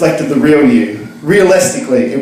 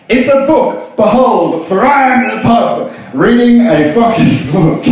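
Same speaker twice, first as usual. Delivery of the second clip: mock grand reader voice, raised; pompous reader; 7 semitones higher than usual. To the barman, a hammed-up grand reader. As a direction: proudly declare it to the pub. Acting out pub scene, reader.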